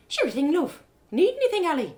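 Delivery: a friendly tavern barmaid welcoming a stranger; cheerful barmaid. Acted. Rose serves at the bar.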